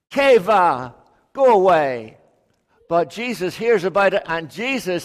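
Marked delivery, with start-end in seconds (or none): 0.1-2.2 shooing away. Acted. Disciples shoo the children.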